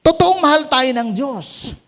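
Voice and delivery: ordinary talk, nothing marked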